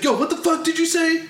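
high-pitched mock outrage; indignant comeback. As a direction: fire it back as an offended challenge. Retelling angry player's loud comeback.

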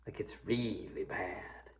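hushed whisper, really bad; whispered aside. Real, not acted. Preacher confiding to the congregation in a whisper. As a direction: drop to a conspiratorial whisper. Aside after secular court.

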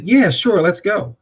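bright, high casual yes to hide nerves; feigned confidence. Retold or imitated. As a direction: fake it like you golf all the time. Too embarrassed to admit never played.

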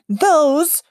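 a loud angry growl; growling loudly. A narrator's reading. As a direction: growl it at the queen. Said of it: "she growled loudly".

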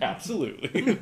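laughing. Retold or imitated. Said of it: "I'm like".

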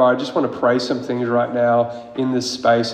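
usual speaking voice

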